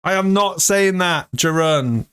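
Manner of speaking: ordinary talk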